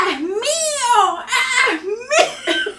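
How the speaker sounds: high, drawn-out frantic scream; hammed-up screech, stretched long; screaming possessively. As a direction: wail it like a madwoman claiming her prize. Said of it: "Y yo le gritaba".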